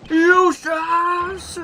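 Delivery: high, loud cry of a name; desperate shout. Acted. Father shouting after his departing son, high and loud. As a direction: yell after him as he walks away. Villain cries out as son leaves.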